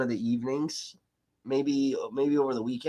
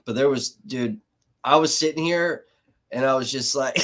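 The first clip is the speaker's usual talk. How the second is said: normal talking voice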